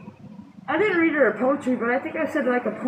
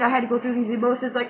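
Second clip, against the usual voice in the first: ordinary talk, nothing marked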